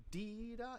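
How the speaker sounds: accented sung demo. Shown as a demonstration. Bandleader singing the unwanted accent, high sung demo. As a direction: sing the accented figure high. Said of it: "No, it's doo doo da".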